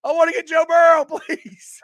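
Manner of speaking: pleading. Retold or imitated. Fan retelling his hope for the top pick, high laughing plea. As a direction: beg half-laughing. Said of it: "I'm like".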